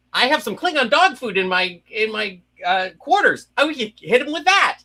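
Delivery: a friend imitating an overexcited Spock voice; excited Spock voice. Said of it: "you were supposed to be Spock, super excited".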